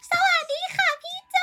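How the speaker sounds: very high squeaky hello; cutesy high-pitched thank-you to a viewer; high-pitched greeting. Real, not acted. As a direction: push the voice way up in a playful hello. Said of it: "หนูเสียงสูงกว่าหนูชนะ".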